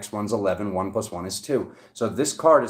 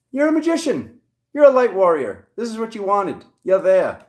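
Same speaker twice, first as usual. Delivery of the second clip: high, loud hyped-up cheer; excited hype; 9 semitones higher, 7 dB louder than usual. Real, not acted. Tarot reader hyping up her viewers, excited and loud. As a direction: pump the viewer up, big and high.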